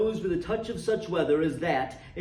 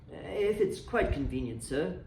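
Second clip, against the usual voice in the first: timid clerk; 6 dB quieter than usual. A narrator's reading. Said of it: "The clerk".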